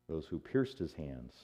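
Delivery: ordinary talk, nothing marked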